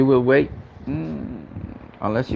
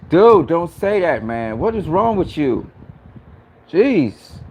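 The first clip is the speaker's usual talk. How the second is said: higher, louder, slow pleading protest; upset outcry; 6 semitones higher, 4 dB louder, slower than usual. Real, not acted. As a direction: cry out in alarm at the friend. Upset by chat death talk.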